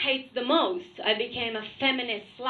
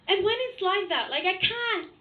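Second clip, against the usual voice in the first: normal talking voice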